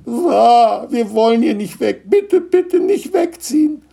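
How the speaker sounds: put-on very high childlike wail; heartbroken crying. Gnome children beg not to move, wailing tearfully. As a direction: beg through hammed-up sobs. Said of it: "ganz herzzerreißend zu weinen".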